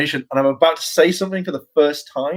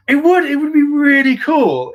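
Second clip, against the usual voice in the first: excited; 13 semitones higher, 6 dB louder than usual. Real, not acted. Excited about being a silent Ranger.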